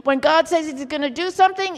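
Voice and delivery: ordinary talk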